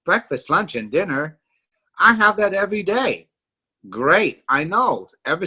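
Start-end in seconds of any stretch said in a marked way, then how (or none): 0.1-3.3 puzzled skeptic. Retold or imitated. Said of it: "you might be scratching your head".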